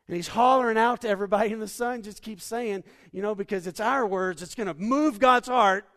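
fiery revival preaching of the father's embrace; full-force preaching. Acted. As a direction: preach it big, building to a holler.

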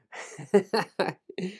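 high-pitched giggling fit; real laugh. Real, not acted. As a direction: burst out laughing at your own stumble.

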